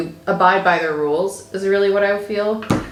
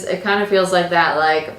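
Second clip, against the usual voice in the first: ordinary talk, nothing marked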